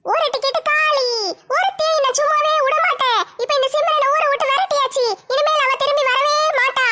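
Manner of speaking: very high-pitched villainess voice; scheming woman, gloating. Acted. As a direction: plot the next move in a high, triumphant voice. (சூழ்ச்சிக்காரியின் வெற்றிக் களிப்பான கீச்சுக் குரல்)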